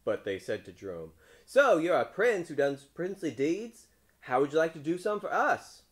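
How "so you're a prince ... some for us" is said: high, loud crowd of villagers; teasing townspeople. A narrator's reading. Townspeople humouring the frog prince, loud high teasing voice. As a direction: call it out loudly, pitched up.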